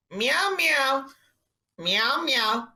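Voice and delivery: drawn-out, teasing meow; imitated cat meow. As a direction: mimic a salesman meowing like a cat. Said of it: "He just meowed at you".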